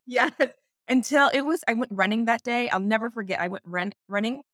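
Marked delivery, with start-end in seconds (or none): none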